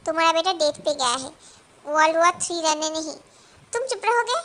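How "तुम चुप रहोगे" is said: sharp, higher, louder retort; angry snap. Acted. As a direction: shut him up, hard. Wife snapping at husband.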